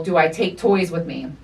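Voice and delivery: usual speaking voice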